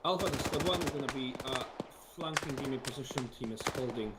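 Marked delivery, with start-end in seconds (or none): none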